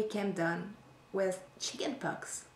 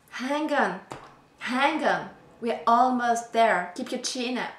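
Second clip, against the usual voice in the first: husband's pep talk; 3 semitones higher, 6 dB louder than usual. Acted. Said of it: "when I complain, he says".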